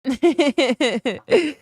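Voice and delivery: high amused laugh; laughter. Real, not acted. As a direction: burst out laughing. Amused reaction to the boyfriend reveal.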